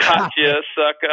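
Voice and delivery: loud, high teasing crow; acting out a prankster's gotcha; gloating prank reveal. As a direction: crow it loudly at your victim. Retold prankster revealing the joke.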